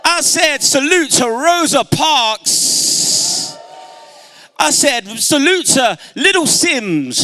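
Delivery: high, loud, drawn-out salute; rallying shout. Real, not acted. Speaker leading the crowd in saluting heroines, rousing shout. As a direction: shout each name out to the whole hall.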